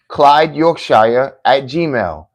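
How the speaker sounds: letter by letter, slow and loud; loud, deliberate address for the viewers; slow spelling. Shown as a demonstration. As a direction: spell it slowly so they get it right. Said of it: "C L Y D E".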